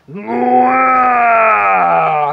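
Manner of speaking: long drawn-out scream of a name; terrifying yell. Retold or imitated. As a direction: bellow the name to freeze him. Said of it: "gritó". (grito aterrador alargado)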